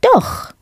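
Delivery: doch jumped high and short; emphatic doch. Shown as a demonstration. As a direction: snap back "Doch." sharply to cancel the no. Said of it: "with that emphatic doch answer".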